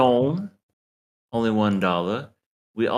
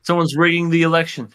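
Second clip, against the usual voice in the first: mock outrage; 5 semitones higher, 4 dB louder, faster than usual. Real, not acted. Mock outrage at rigged vote.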